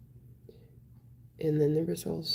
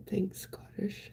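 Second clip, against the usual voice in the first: whisper; 10 dB quieter, slower than usual. Real, not acted. Quiet thanks to a viewer.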